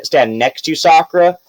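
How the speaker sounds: ordinary talk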